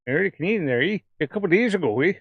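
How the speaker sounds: sing-song Canadian hoser with swinging pitch; hammed-up Canadian hoser talk; Canadian hoser accent. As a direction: lay on a mock Canadian accent, lilting up and down. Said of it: "That's me trying to do a hoser accent".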